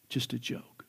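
breathy whispered aside, voice dropped away; a sly whisper to the congregation. Real, not acted. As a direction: let it slip out under your breath as a joke. Whispered aside after the joke.

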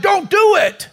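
desperate high shout to a man on a bridge. Acted. Rescuer shouts at the man about to jump, high desperate shout. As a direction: yell it out to stop him jumping. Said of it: "He shouted".

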